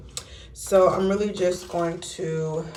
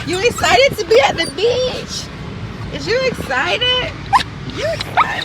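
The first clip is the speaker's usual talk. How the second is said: high gushing puppy talk; excited pet-talk; 7 dB louder than usual. Real, not acted. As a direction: hype the dog up, squealing. Hyping up the dog.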